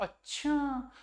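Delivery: a teacher showing how wonder sounds; amazed. Shown as a demonstration. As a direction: react with wonder to the news. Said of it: "उसमें कहेंगे".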